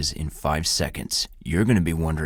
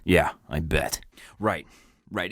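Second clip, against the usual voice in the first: ordinary talk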